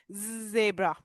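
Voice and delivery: ज़ेब्रा with the opening Z drawn out; buzzing Z. Shown as a demonstration. Teacher giving Z example, buzzed ज़ेब्रा. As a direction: buzz into the start of ज़ेब्रा. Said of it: "buzzing B होती है तो".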